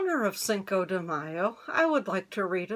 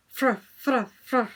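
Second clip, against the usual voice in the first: shaking-off sound; 4 semitones higher, 4 dB louder than usual. Acted. Coyote shakes off water.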